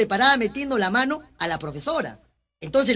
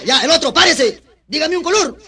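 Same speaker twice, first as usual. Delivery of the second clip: loud, high command; acted bullying order; 6 semitones higher, 8 dB louder than usual. Ex-convict ordering the last child to stand, loud acted command. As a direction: call the pupil up sharply.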